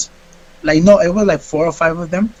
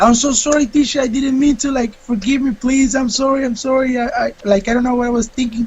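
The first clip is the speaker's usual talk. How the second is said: pleading apology; 6 semitones higher than usual. Retold or imitated. Student begging teacher not to expel him, higher pleading. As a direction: beg forgiveness in a rush.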